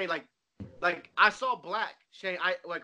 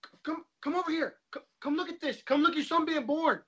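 high, urgent beckoning as the doctor; imitated doctor; 9 semitones higher than usual. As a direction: call the new dad over, pitched up high. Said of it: "I remember he said".

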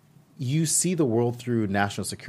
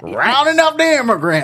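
loud, high cartoon gunslinger holler; Yosemite Sam; 10 dB louder than usual. Host mocking Joe Arpaio as a cartoon, loud high yell. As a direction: holler it like a hammed-up cartoon cowboy. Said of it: "reminds me of Yosemite Sam".

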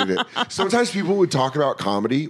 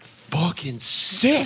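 drawn-out, raised-pitch mock excitement; sarcastic delight; 7 semitones higher, much slower than usual. Waiter reacting to a big pricey table, drawn-out mock excitement. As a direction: stretch each word out, pitched high.

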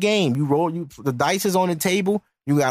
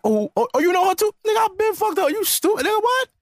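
much higher, shocked gossiping voice; mock gossip squeal; 13 semitones higher than usual. Mimicking a guy shocked she slept around, squealing high. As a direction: squeal the rumor in disbelief.

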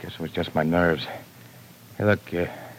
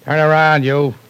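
loud, hard command shouted at her; barked order; 8 semitones higher, 7 dB louder than usual. Acted. Gangster shouting at his captive to turn around, loud barked order. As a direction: bark the order so she has no choice.

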